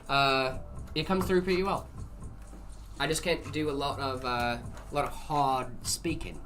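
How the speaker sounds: understated British attempt; British accent. Shown as a demonstration. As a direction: keep up a gentle British accent. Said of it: "Is this a decent British accent?".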